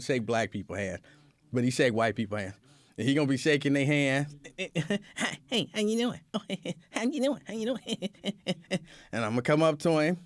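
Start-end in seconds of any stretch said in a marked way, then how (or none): 4.6-8.1 lightly voiced glad-handing politician, higher; chirpy handshake greeting, pitched up; mock politician greeting. Imitating a politician greeting a crowd, bright higher voice.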